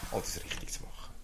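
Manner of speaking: breathy unvoiced whisper. Preacher mocking legalism to the church, in a whisper. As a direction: hush it like a mocking aside. Mocking the goal of doing right.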